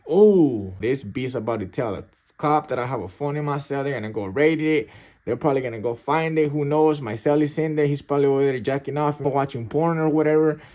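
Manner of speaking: loud, worked-up retelling; alarmed inner panic. Retold or imitated. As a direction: burst out loud, then rattle off the worries. Imagines the cops raiding cell.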